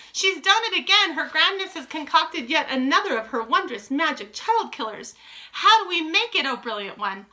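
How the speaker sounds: high-pitched, excited admirers; adoring witch audience. A narrator's reading. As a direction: gush like devoted followers of the leader.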